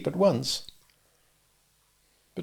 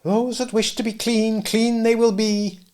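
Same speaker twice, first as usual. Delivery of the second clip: Irishwoman; 9 semitones higher than usual. Acted. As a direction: intone it like a prophecy.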